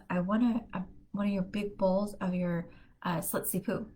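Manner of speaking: ordinary talk, nothing marked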